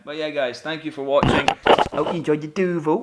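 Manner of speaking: loud, upbeat presenter outro; cheerful loud YouTube goodbye; vlog sign-off. Acted. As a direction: sign off to the viewers loudly.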